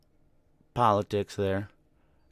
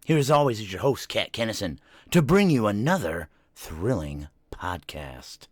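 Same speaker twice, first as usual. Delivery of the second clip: big, loud, higher-pitched show-opener voice; announcer intro; 5 semitones higher, 8 dB louder than usual. Acted. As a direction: announce the podcast with hammed-up fanfare, stretching the last words. Opens the podcast like an announcer.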